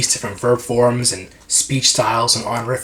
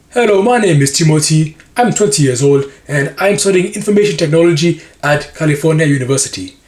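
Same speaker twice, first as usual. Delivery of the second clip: Nigerian-accented English, a touch louder; 5 semitones higher, 5 dB louder than usual. Shown as a demonstration. Demoing a job intro with a Nigerian accent. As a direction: speak it with a Nigerian lilt. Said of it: "one was Nigerian".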